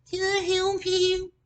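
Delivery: high slow mock-polite Can I help you; snooty driver. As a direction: mimic a condescending stranger behind tinted glass. Driver rolls window down.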